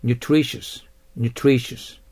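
"nutritious" repeated with stress on TRI; accent demonstration. Shown as a demonstration. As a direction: model "nutritious" for learners, hitting the middle syllable. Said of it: "アクセントに気をつけてください". (第二音節にアクセントを置く「nutritious」)

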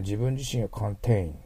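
ordinary delivery

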